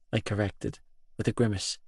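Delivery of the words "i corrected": ordinary delivery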